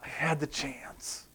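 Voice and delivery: hushed, slow regret fading away; quiet anguish. Acted. Preacher voicing a soul in hell, hushed anguished regret. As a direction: sigh it out, nearly whispered. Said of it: "the emotional anguish of".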